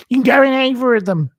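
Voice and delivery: high, loud and fast mock of a rude man; mocking the van driver. Retelling the rude van driver at the petrol station, in a high, fast mock voice. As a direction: sneer his words back at him, hammed up. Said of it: "this man came behind me and went".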